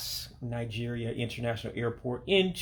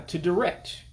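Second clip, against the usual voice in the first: ordinary talk, nothing marked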